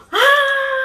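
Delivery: high, loud drawn-out "ah"; excited squeal. Retold or imitated. Wrestler retells a colleague greeting her after the match, imitated high squeal. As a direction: imitate a thrilled high-pitched cry. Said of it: "he was like".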